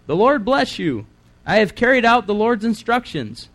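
high-pitched, eager proclamation; overeager, high announcement of obedience; chirpy Saul greeting. Acted. Reader voices Saul's overeager greeting.

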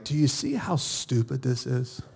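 God's quiet chuckling voice; soft amused whisper. Retold or imitated. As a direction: let it slip out like a gentle amused secret. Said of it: "God whispered to me".

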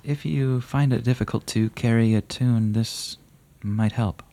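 a dark rider lightly joking; playful rider. Acted. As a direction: offer it with a sly wink. GM voices Gaspard.

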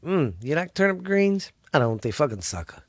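ordinary talk, nothing marked

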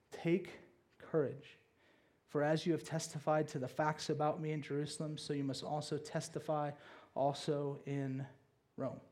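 low, soft, solemn reading; hushed words of comfort; gentle encouragement. A narrator's reading. Reads the Lord encouraging Paul at night.

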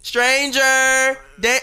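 high slow drawn-out call; sing-song call. Shown as a demonstration. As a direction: call out slowly, high pitched. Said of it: "I say that ten times".

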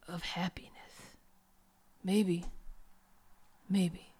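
a low, lightly whispered tortured hero; brooding hushed monologue. Acted. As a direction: murmur it like a broken man. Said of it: "And then he says".

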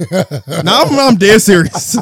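loud, high and drawn out through laughter; laughing insistence. Real, not acted. Telling a friend his theory is real, laughing insistence. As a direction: insist over the laughs, cracking up.

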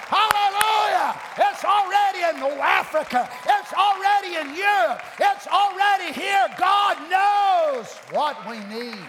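fervent preacher crying out in victory; triumphant preaching. Real, not acted. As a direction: taunt the devil, then erupt in praise. Preaches triumph over the devil.

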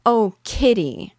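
slow, loud, high anguished cry. A narrator's reading. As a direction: cry out in distress.

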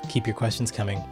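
usual speaking voice

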